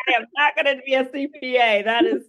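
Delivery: high-pitched, amused retold refusal; laughing refusal. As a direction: laugh through an emphatic mock refusal.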